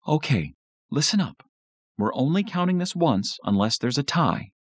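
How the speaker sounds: high-pitched mom announcement; mother taking charge. A narrator's reading. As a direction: call the family to attention. Mom character announces the count.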